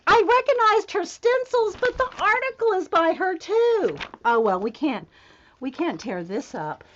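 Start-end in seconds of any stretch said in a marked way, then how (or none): 0.1-4.0 high-pitched amazed explaining; excited, high fan delight; thrilled excitement. Real, not acted. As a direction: gush about the discovery, voice way up. Thrilled about the article's author.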